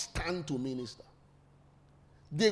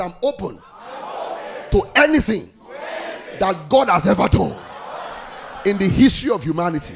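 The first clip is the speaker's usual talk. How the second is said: "open", "anything", "God" and "humanity" all shouted much louder; 10 dB louder than usual. Real, not acted. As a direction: belt it out as the congregation. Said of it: "You didn't say it loud".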